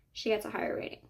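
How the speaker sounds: ordinary talk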